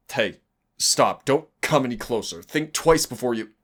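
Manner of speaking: alarmed plea. Acted. Warhammer approaching, trapped demon.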